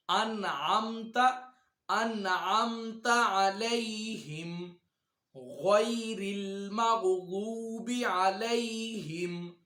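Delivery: slow, melodic tajweed recitation, drawn out; slow Quranic recitation. Shown as a demonstration. Teacher reciting slowly for students to follow. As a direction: recite each word slowly for students to repeat.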